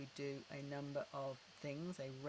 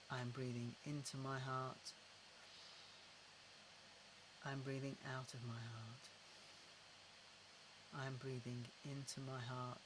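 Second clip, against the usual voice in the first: unhurried, gentle repetition into the heart; guided breathing; 7 dB quieter than usual. Shown as a demonstration.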